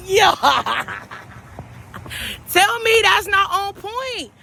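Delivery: usual speaking voice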